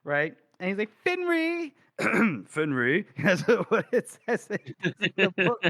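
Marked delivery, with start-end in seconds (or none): 1.0-1.7 lovestruck call, far above the usual pitch; high eager call. Acted. Gorst spotting Finree, high giddy call. Hero calls out to beloved.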